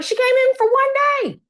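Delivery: high, mocking squeal; a squeaky mock of her excuse. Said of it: "And I was like".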